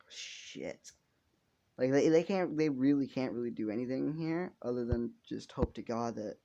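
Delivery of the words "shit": soft, voiceless curse; barely audible acted whisper; hushed stunned curse. Second acted stunned reaction.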